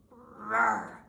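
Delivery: loud rumbling roar; bear roar. Acted. Bear answers Baba Brena with a loud roar. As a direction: roar like an angry old bear. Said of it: "Old Bear roared".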